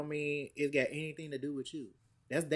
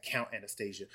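stern dominant; 5 semitones lower, 10 dB quieter than usual. Retold or imitated. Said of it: "he spanks her and he's like".

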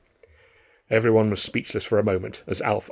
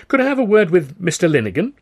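high, louder, smiling ask; friendly request; 9 semitones higher, 6 dB louder than usual. A narrator's reading. Alf asks receptionist for the manager, friendly. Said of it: "said with a friendly smile".

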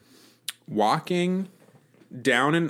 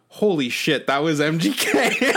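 breathless laughing disbelief, a little higher; stunned realization; 6 semitones higher, 3 dB louder than usual. Retold or imitated. After the tall guy passes, realizing it was [name], laughing disbelief. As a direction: gasp it out as it dawns on you. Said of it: "I stopped and I was like".

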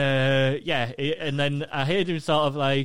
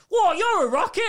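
mock cheeky exclaim. Cheeky pun to a stranger.

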